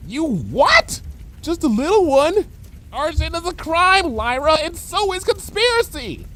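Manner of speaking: high, scandalised pony voice; shocked scolding. Acted. Bon Bon scolding Lyra in a high scandalised voice.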